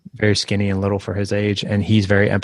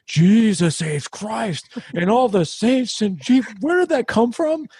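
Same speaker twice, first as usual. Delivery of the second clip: high, slow, awestruck cornerman exclaiming; drawn-out, high gasp of amazed disbelief; Paul Giamatti in awe; 12 semitones higher, slower than usual. Retold or imitated. Dad retelling the Cinderella Man comeback, high slow amazed exclaim. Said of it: "and then Paul Giamatti was like".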